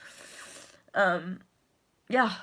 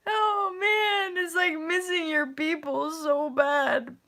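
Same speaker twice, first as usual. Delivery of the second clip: tearful, higher and slow; real crying; 6 semitones higher, slower than usual. Real, not acted. Sharing her homesickness with viewers, real tears. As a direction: voice breaking with longing. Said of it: "the longing in my heart".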